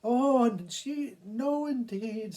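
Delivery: a woman shrieking at a mouse; frightened woman. Acted.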